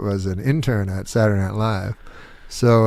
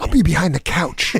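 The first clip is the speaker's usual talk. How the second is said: imitated punchline jumping higher; Josh; 6 semitones higher than usual. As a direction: deliver the punchline in a put-on, raised voice. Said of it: "Josh, this is how he talks".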